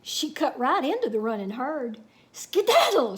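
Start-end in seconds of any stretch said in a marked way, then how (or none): 2.3-3.2 a loud, high whoop. A narrator's reading. Cowgirl scattering the longhorns with a loud whoop. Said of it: "she whooped".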